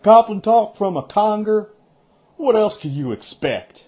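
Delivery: high, loud scoffing disbelief; scornful snort. Acted. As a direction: scoff at the nonsense. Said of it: "Perrin snorted".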